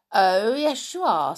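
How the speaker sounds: loud, firm contradiction; firm insistence. A narrator's reading. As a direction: insist firmly, no arguing. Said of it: "said Binkle firmly".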